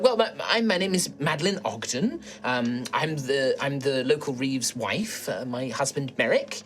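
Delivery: polite, flustered host introducing herself; old halfling woman. Acted. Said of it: "she will turn to you and be like".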